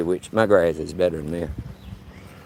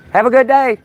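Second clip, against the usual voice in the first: much higher and louder friendly goodbye; cheerful call-out; 7 dB louder than usual. Real, not acted. Waving off the porch lady, high and loud. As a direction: sing out a goodbye across the street.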